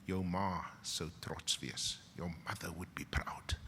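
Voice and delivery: barely voiced, hushed and tender; soft whisper. Whispering his praise to a graduate whose mother is gone. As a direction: whisper it like a private message. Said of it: "whispered Facebook message".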